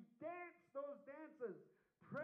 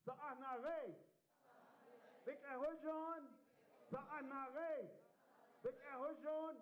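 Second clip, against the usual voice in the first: firm, raised-pitch prayer phrases; prayer call-out; 4 semitones higher, slower than usual. Shown as a demonstration. Leader has the audience repeat after him, chanted prayer. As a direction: call each phrase for the group to echo. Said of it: "Just repeat after me".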